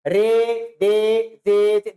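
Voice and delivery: रे and दे drawn out very slowly; slow letter names. Shown as a demonstration. Teacher drawing out रे and दे slowly for students. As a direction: stretch each letter for the class to hear. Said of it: "देखो आवाज इनकी कैसे आती है".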